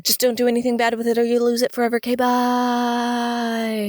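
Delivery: rattled-off warning, long-held bye; mock hurried farewell. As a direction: rush through it, then stretch the goodbye. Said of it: "Aurora Borealis lady's like".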